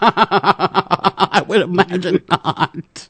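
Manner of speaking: laughing through the words. Real, not acted. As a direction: chuckle while saying it.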